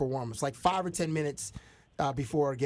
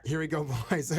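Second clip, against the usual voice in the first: put-on Swedish accent broken by a laugh; Swedish-accent quote through a laugh. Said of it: "In Swedish, in a Swedish accent".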